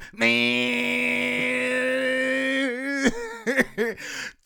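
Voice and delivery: power saw whine done by mouth; chainsaw impression. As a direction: imitate a buzzing chainsaw. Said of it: "It's chainsaw impression".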